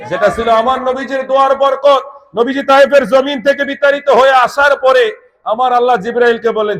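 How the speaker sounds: high, driving sermon narration; impassioned preaching. Real, not acted. Preaches at full force. (চড়া গলায় জোরালো ওয়াজ)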